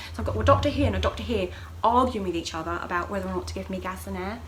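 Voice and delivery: ordinary talk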